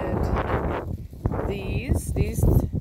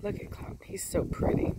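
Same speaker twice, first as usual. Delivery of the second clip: ordinary talk, nothing marked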